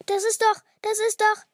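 high, loud, stammered repeat; astonished. A narrator's reading. Girl staring at her present, astonished. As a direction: falter in amazement, high and loud. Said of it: "Sie staunte".

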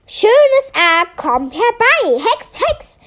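high, chanted magic spell; calling a spell. A narrator's reading. Melia casts a spell in class, calling it out. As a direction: cast the spell aloud. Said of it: "rief Melia".

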